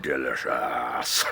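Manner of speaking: deep, slow, savoured "Delicious"; low wolf voice. Acted. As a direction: let the wolf relish it, very low. Wolf after eating pig.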